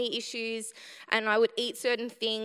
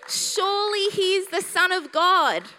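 louder, awed "Surely he is the Son of God"; awed declaration; 7 semitones higher, 7 dB louder than usual. Acted. Preacher quoting the centurion, proclaimed loud in awe. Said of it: "the true awareness".

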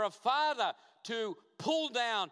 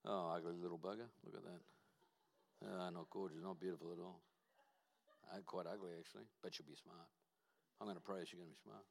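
mock father; 14 semitones lower, 15 dB quieter, faster than usual. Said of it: "What did you say?".

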